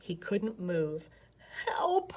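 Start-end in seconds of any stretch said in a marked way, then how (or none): 1.6-2.1 croaked. A narrator's reading. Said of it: "he croaked".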